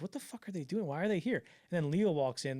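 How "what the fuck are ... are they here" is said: higher-pitched mock disbelief; retold bafflement. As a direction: imitate a puzzled, put-out director. Director puzzled by crowded meeting.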